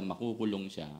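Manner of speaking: ordinary delivery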